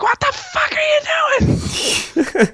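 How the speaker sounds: very high, loud mock scream of a horrified mom; imitating a scream. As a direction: yell at the boy holding her under.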